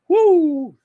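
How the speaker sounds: high, loud, sustained "woo"; excited whoop. Real, not acted. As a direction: let out a long high whoop. Reacting to heated wrestling drama.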